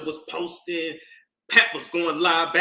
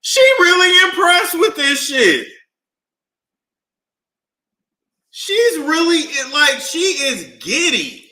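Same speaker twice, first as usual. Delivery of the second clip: excited; 14 semitones higher, 10 dB louder than usual. Real, not acted. Host laughing at boxer's giddiness.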